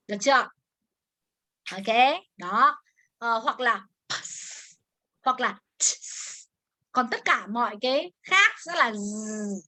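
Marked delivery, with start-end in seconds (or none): 3.2-6.4 ps and ts said slowly and softly; slow soft demo of ps and ts; ps and ts clusters. Shown as a demonstration. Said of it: "ps, ts".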